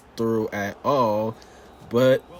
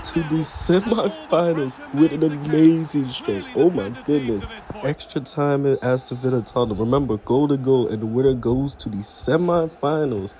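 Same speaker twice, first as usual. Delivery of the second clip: excited play-by-play; 3 semitones higher, 5 dB louder than usual. Acted. Narrating penalties and golden goals to viewers, excited play-by-play. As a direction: call the goals like a live commentator. Calls the simulated matches.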